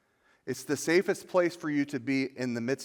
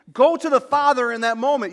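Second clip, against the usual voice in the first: "Go" hit higher and louder; preached loud; 10 semitones higher, 8 dB louder than usual. Shown as a demonstration. Pastor urging the congregation, loud high exhortation. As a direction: lift "Go to the Father" into a loud, high cry.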